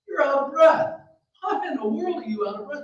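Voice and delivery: a touch of motherly alarm; questioning mother. Acted. Mother questions breathless son.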